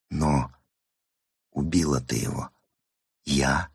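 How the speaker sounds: low, hushed inner voice; repeating to oneself. A narrator's reading. Woman repeats it to herself for confidence, hushed inner voice. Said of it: "повторяла она про себя".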